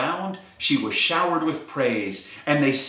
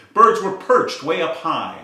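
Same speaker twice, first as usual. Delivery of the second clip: usual speaking voice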